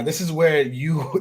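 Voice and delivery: usual speaking voice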